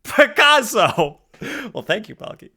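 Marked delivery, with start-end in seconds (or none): 0.0-1.2 excited shouting praise. Real, not acted. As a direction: cheer the fan like a proud parent. Hyped praise of chat's art.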